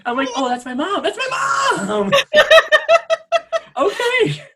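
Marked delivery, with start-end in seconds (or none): 0.4-2.1 squealing through laughter, high; laughing. Retold or imitated. Laughing at mom casting mix-up. 2.1-3.7 shrieking burst of laughter; loud high laugh. Real, not acted. Big laugh after joke.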